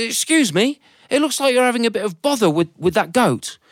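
high, courteous passer-by voice; a helpful stranger, politely concerned; polite gentleman. Acted. Passing gentleman offers help.

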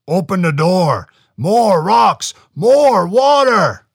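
loud, booming, slow giant shouts; giant calling. A narrator's reading. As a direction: bellow the demands again. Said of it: "The Chenu would only call for them again".

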